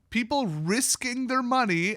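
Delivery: risking slow and pitched high; stress on risking. Real, not acted. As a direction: lean hard on the word risking. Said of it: "I cannot stress the word risking enough".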